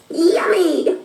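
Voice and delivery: very high delighted yummy; high cartoon delight; eager creature voice. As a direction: squeal it in a funny high voice. Character reacts to treat.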